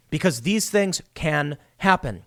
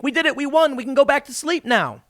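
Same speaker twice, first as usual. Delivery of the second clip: smug mock gloating; 9 semitones higher than usual. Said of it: "leftists just think".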